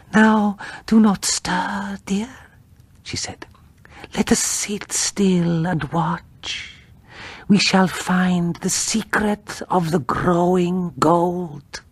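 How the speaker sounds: enchanted by the gold, voice drifting; eerie feverish wife. Acted. Wife tells husband to sit still.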